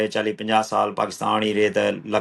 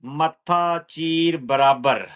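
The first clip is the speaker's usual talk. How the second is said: slow, measured verse with drawn-out words; poetry recital; 5 semitones higher, much slower than usual. Acted. Poet reciting own lines to an appreciative listener, measured recital. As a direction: recite it higher, easing down at the close.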